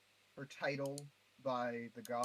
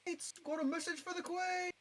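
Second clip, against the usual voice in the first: shouting up. Acted. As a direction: scream it up to the walls. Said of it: "Charles screams up".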